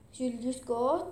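ordinary delivery, nothing marked

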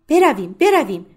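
فراخوان فوری و بلند; urgent call. Acted. Urging blind women to flee. (صدای بلند و مصرانه برای فرار)

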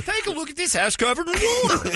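very high, excited pitchman; Flex Seal guy. Acted. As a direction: sell it like a hammed-up infomercial host. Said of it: "the Flex Seal guy".

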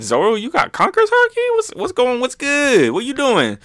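a hyped friend asking if that's Conqueror's Haki; mock amazed. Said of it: "Everyone's thinking, like".

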